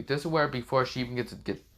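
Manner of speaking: ordinary delivery, nothing marked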